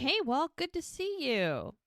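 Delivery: high-pitched hello, drawn out at the end; cheery retold greeting. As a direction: greet him high and friendly, stretch the ending. Retold friendly greeting to Walt.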